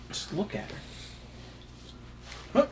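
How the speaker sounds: soft breathy whisper. Acted. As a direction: breathe it out admiringly. Secret talk before proposal.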